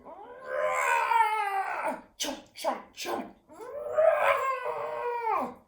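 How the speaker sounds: big high roar with chomping; lion roar and chomp. A narrator's reading. Ernie roaring at the robber in lion mode. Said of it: "launched into lion mode".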